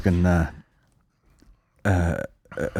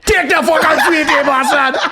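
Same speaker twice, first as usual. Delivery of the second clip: sudden higher, louder laughing yell; mock angry shout; 5 dB louder than usual. Mocking the boxer's sudden switch, mock angry shout. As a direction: snap from sweet to furious, hammed up. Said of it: "such a funny switch".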